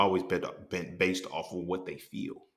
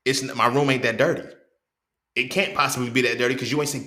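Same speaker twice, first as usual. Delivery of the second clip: ordinary talk, nothing marked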